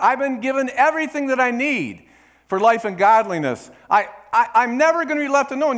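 loud, high fervent preaching; full-force sermon climax. Acted. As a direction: declare it with fire.

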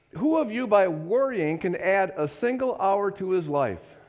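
fervent Bible reading; scripture reading. Acted. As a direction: read the passage as a sermon. Reads scripture with fervour.

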